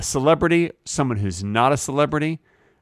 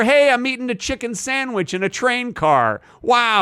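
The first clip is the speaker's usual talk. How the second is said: excited diner; 9 semitones higher than usual. Retold or imitated.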